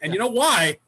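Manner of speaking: normal talking voice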